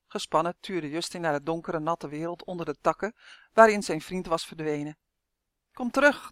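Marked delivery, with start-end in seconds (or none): 5.7-6.3 called out higher; a worried, rising call; calling in his head. A narrator's reading. Boy calling after his vanished duck friend, rising. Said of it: "dacht hij".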